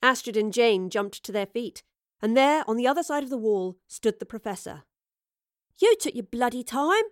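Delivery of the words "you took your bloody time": cross grumble, pitched up; mildly annoyed reproach, higher; frowning complaint. A narrator's reading. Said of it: "Astrid frowned".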